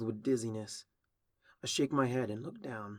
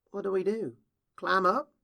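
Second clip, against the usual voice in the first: anxious; 9 semitones higher, 7 dB louder than usual. Acted. Anxious, stuck in well.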